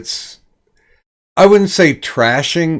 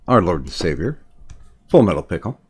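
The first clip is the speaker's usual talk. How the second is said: mock reverent; 7 semitones lower, 4 dB quieter, much faster than usual.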